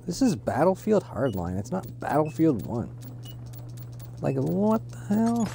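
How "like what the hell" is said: much higher, slow, stretched disbelief; high incredulous. Real, not acted. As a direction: pitch it way up in outrage. Mocked for hiding.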